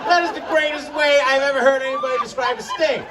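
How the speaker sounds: astonished. Retold or imitated. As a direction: react in delighted shock. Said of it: "I'm like".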